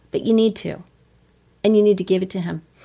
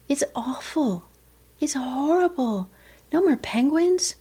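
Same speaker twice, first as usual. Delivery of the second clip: mourning; 6 semitones higher, slower than usual. Acted. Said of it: "to mourn means to say".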